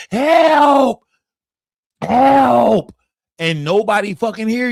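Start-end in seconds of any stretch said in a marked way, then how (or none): 0.1-2.9 high, drawn-out mock calls for help; imitated screaming. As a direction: act out screaming that nobody hears.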